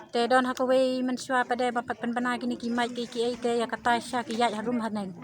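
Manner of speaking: usual speaking voice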